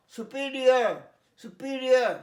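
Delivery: সুপিরিয়র loud, su-PI-riar; correct SUPERIOR. Shown as a demonstration. Teacher telling pupils to listen closely, emphatic su-PI-riar in সুপিরিয়র. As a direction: drill সুপিরিয়র forcefully with "iar" at the end. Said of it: "কান পেতে শোনো".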